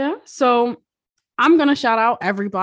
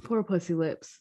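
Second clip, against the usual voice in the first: much softer, murmured pity; soft mock pity; 2 semitones lower, 8 dB quieter than usual. Co-host murmuring the nickname again in soft mock pity. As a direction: mutter it under your breath with fake sorrow.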